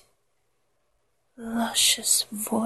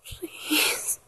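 whimpering plea; 2 semitones higher, slower than usual. Acted. Lover pleading in a partner's ear, whimpering whisper. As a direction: whimper each plea on a breath, trailing away. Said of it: "Just whimpering in your ear".

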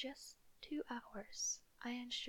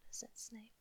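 ordinary delivery

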